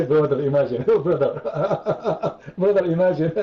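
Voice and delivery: laughing through the words. Real, not acted. Mocking the card from Allah while laughing. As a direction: laugh out the word brother.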